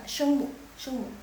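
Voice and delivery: ordinary delivery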